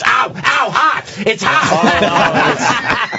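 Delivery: high yelping ow, ow, hot; mock yelp of pain. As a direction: squeal like a burned finger, half laughing. Fiancee burned by hot ring.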